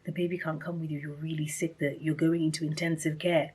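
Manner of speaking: soft, serious retelling of bad news; grave hushed news. Hospital staff telling a new mother she's very sick, grave and hushed. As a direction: break hard news very quietly. Retold grave news after room goes quiet.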